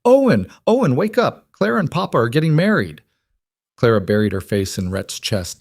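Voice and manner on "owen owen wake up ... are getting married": put-on high-pitched excited girl calling out; yelling. A narrator's reading. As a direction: holler the big news down the hall. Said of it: "running down the hall yelling".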